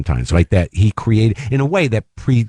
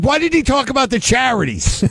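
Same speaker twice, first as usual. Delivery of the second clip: much higher, mock outraged demand; imitating a yell. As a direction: squeal the accusation like an angry old man. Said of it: "Shecky Greene yelling at me".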